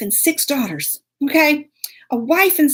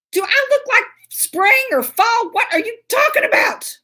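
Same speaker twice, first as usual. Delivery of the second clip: much higher, indignant questions; outraged disbelief; 10 semitones higher than usual. Retold or imitated. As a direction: demand it in high-pitched disbelief. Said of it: "I'm like".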